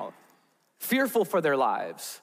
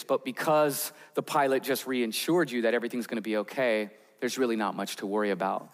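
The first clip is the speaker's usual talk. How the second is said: ordinary talk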